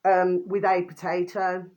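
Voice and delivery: slow, spelled-out title reading; slow dictation. Shown as a demonstration. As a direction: read it out slowly for viewers. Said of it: "the video should read".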